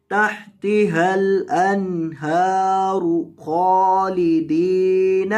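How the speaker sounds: slow, melodic tilawat; Quran recitation. Acted. Teacher reciting to students, slow and melodic. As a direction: chant the phrase in measured recitation.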